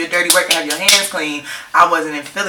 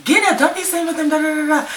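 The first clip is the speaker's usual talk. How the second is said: imitating the girlfriend, much higher and fast; hammed-up shrieking order to get up; mock yelling girlfriend; 11 semitones higher, faster than usual. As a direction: girlfriend ordering her boyfriend away from the new girl in a high, fast mock yell. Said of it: "his girlfriend was like".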